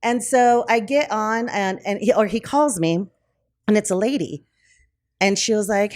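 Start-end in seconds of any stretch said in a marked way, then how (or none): none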